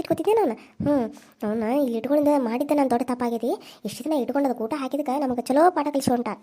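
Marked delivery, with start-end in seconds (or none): none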